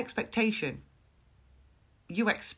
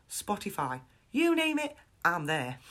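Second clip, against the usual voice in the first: ordinary talk, nothing marked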